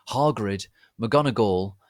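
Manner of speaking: plain reading voice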